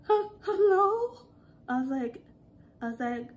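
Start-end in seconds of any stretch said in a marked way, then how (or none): 0.1-1.2 high, slow, hesitant hello; shaky, terrified caller. Retold or imitated. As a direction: imitate a scared customer, hesitating. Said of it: "her voice sounded so shaky".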